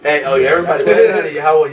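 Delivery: loud call to the whole class. Real, not acted. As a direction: grab everyone's attention. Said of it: "calling out to class".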